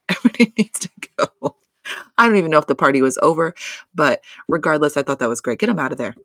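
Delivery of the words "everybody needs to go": laughing. Retold or imitated. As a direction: repeat it higher and faster through a laugh. Repeats Gigi's line laughing.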